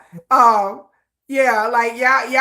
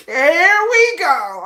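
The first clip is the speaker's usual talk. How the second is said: higher, louder, very slow "Here we go."; mock-exasperated repeat; 9 semitones higher, 7 dB louder, much slower than usual. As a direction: stretch "Here we go." out in loud disbelief.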